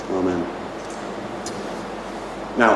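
ordinary talk

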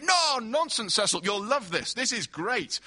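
high, loud, brushing the request aside; overbearing enthusiasm. Acted. Host overriding his guest, loudly enthusiastic. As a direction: gush over him and ignore the objection.

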